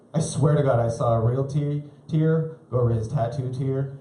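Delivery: ordinary delivery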